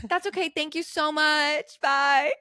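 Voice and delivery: overly polite, high-pitched goodbye; fake-polite brush-off. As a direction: dismiss them with exaggerated sweetness. Acts out brushing the man off.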